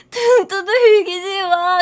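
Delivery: slow, loud crying don't pat my back; sobbing plea. Retold or imitated. 등 두들기는 친구에게 오열하며 애원, sobbing. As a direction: burst into a wailing plea. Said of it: "등 두들기는 순간".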